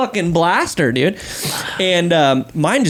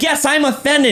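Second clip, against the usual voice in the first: higher put-on child voice; offended little kid; 9 semitones higher than usual. Six-year-old answering the puzzled dad, high and offended. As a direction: imitate a small boy insisting.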